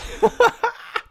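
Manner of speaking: high squealing laugh burst. Real, not acted.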